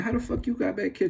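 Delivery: high-pitched acted disbelief; baffled questioning. Acted baffled friends questioning him.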